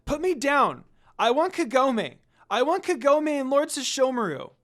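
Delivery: put-on loud, high childish wail; kidnapped child yelling for her protectors; desperate shout. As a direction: cry out for Kagome with all you have. Girl carried off by monk.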